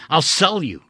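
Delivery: loud, cutting threat; angry threat. Acted. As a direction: spit the threat out.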